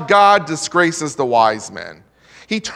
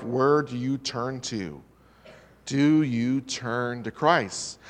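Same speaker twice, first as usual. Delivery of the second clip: slow, soft appeal; 6 semitones lower, 7 dB quieter, slower than usual. Acted. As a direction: drop low and slow, pleading softly. Preacher's pointed question to Christians.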